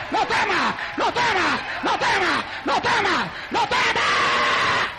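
high, shouted preaching with hammered repeats; full-force preaching. Real, not acted. Preacher rousing the congregation against fear, full-force preaching.

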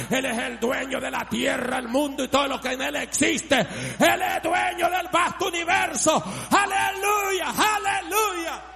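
rising from forceful to high shouting; preaching building to shouts. Real, not acted. Preacher declaring God owner of the universe, preaching building to shouts. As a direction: climb to full-voiced hallelujahs.

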